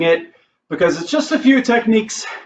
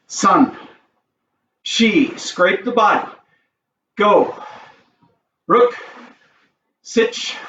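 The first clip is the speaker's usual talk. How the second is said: count calls; much slower than usual. Shown as a demonstration. Counting reps for class.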